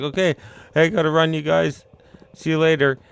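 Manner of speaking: bright, breezy farewell; cheery goodbye. Retold or imitated. Yakuza guy waving goodbye to the friends, cheery. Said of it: "finally he's like".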